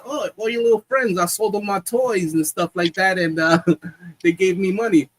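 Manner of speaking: high-pitched retold kid's excuse; lightly voiced child, pitched high; little boy explaining. Son explaining the money to his mom, lightly acted boy.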